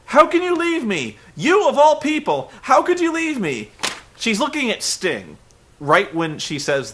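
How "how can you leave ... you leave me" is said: high, loud hurt wail; mock Dixie wail. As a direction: overplay a betrayed boss.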